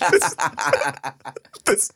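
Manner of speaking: high, slow, laughing through tears; cracking up while trying to talk; telling a friend's story, crying with laughter. Real, not acted.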